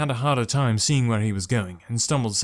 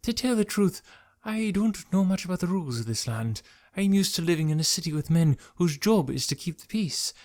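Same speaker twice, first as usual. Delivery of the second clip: higher, steadier nervous man; controlled voice; 6 semitones higher than usual. A narrator's reading. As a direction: try to sound composed. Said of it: "His voice was better under control".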